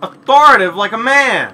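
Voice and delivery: loud, high mock command voice; authoritative. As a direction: bark it like a manly boss. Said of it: "It's authority".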